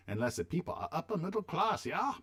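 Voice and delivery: put-on a deliberately terrible German accent; mock German accent, jokey; bad German accent. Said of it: "my worst German accent".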